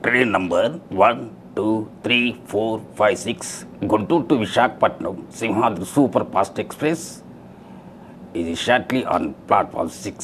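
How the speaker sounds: measured station announcer voice; railway announcement. Shown as a demonstration. Mimic demonstrates a railway station announcement, formal announcer delivery. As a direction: announce trains over the station speakers, slowly. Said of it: "railway స్టేషన్లో మూడు భాషలు వస్తుంటది announce".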